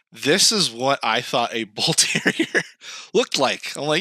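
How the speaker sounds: usual speaking voice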